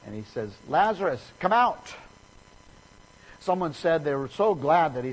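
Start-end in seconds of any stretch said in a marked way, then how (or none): 0.7-2.0 calling out. Retold or imitated. Jesus calling into the tomb.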